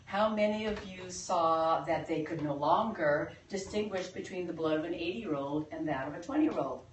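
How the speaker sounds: ordinary talk, nothing marked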